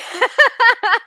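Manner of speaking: high, loud burst of laughter; loud cackle. Real, not acted. Host cackles after innuendo remark.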